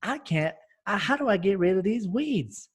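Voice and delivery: high, whiny ad-customer complaint; exaggerated frustration. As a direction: overplay a desperate gardener. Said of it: "I'm like".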